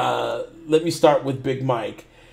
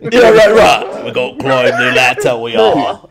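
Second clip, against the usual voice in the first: mock British accent; 9 dB louder than usual. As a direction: ham up an English Kratos, much louder. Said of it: "Could you imagine that?".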